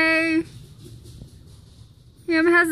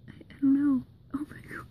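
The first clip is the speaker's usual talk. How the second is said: whispering; 6 dB quieter, faster than usual. Real, not acted. Watching the hamster closely.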